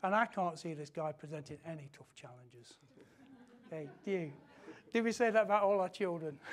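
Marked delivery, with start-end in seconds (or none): none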